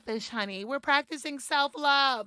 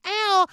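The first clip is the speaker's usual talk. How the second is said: playful yelp; 9 semitones higher, 9 dB louder than usual. Real, not acted. Reacting to own joke.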